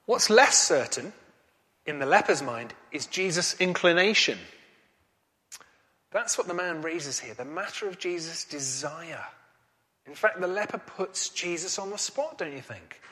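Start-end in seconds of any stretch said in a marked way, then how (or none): none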